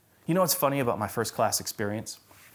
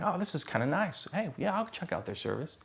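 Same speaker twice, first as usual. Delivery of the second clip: higher-pitched, lightly acted casual interest; casually impressed guest; 6 semitones higher, 5 dB quieter than usual. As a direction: play someone mildly won over, pitched up. Voicing a pleasantly surprised invited guest.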